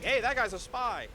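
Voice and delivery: loud, high alarmed cry; mock alarmed shout. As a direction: yell it like a busted spy. Villains spotting the spy, retold.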